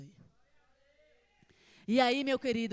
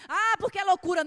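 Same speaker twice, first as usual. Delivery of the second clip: mock doubter; 7 semitones higher, 12 dB louder than usual. Voices a doubter's objection.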